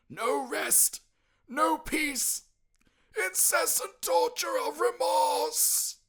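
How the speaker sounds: high, loud, slow anguished ghost; slow wailing spectre of remorse; tormented ghost wail. Acted. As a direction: drag out the torment like a chained spirit. Ghost voice in story.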